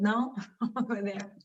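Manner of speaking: usual speaking voice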